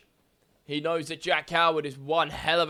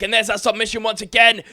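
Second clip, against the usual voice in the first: rising play-by-play excitement, louder and higher; excited call; 5 semitones higher, 9 dB louder, faster than usual. Real, not acted. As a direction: call the submission like it matters. Wrestling play-by-play, big submission move.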